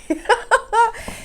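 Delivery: high-pitched embarrassed giggle. Real, not acted. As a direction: burst into a giggle. Laughs at own phrase.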